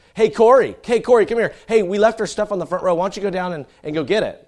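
loud, high call across the church. Retold or imitated. Older son calling his brother in the dark church, loud high call. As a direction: yell for your little brother.

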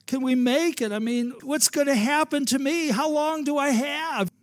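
high, loud, panicked plea to the Lord; fearful cry. Retold or imitated. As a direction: voice a frightened believer crying out. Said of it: "we say".

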